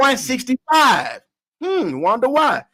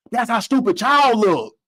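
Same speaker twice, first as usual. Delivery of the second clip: ordinary talk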